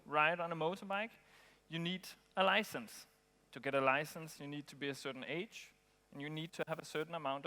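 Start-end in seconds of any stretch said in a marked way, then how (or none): none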